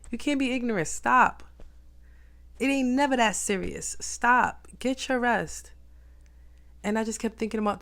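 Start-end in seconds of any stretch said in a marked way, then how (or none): 0.1-5.7 self-scolding. Retold or imitated. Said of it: "I was like".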